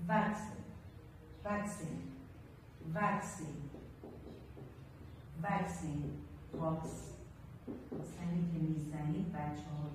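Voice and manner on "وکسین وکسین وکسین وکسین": وکسین said quietly with an e vowel; with the e sound. Shown as a demonstration. A teacher showing the class the e in وکسین.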